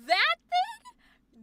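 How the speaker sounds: high, loud mock-panicked squeal; imitated freak-out. Coach imitating the actor's last take, high mock panic. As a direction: squeak it out in exaggerated disbelief. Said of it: "You were like".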